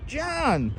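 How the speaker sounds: high, happy thank-you; thrilled and grateful; excited, high thanks. Real, not acted. Thanking viewer for coffee.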